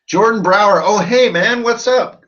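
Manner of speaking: bright eager hello to a viewer; excited greeting. Real, not acted. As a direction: greet a friend you spotted with delight.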